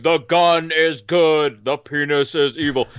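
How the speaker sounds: slow booming voice from above; giant alien booming voice. Retold or imitated. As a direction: boom it out like a giant stone head. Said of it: "a booming voice saying".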